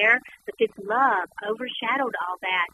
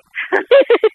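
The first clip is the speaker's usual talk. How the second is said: high, loud giggle; burst of laughter; 10 semitones higher, 11 dB louder than usual. Retold or imitated. Daughter laughing at her dad's gossip, high and loud. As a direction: let the laugh bubble over.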